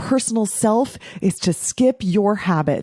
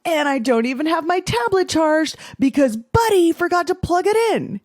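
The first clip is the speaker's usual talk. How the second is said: put-on much higher, sarcastic kid grumble; imitating sulky son; 9 semitones higher than usual. Mother imitating older son Felix blaming his brother sarcastically. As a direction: whine the blame onto his brother. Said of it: "Felix would be like".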